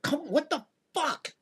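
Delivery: high, exasperated mock viewer; baffled viewer at home. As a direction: squeak out the confusion of a fan on the couch. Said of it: "the audience at home's like".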